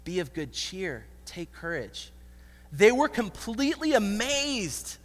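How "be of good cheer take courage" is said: very soft, low encouragement; gently steady a frightened listener; hushed, soothing words; comforting. Acted. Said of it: "Jesus tries to comfort them".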